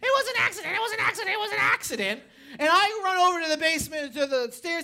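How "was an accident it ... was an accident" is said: high-pitched imitation of a panicked child; mock kid yelling. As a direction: blurt it out high, like a kid protesting. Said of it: "one kid crying and one kid yelling".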